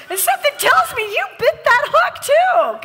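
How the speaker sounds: high, loud, laughing tease; teasing exclaim. Real, not acted. A preacher teasing the crowd for biting the hook, high and loud. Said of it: "preacher teasing congregation".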